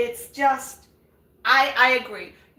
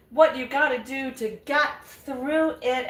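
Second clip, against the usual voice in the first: ordinary delivery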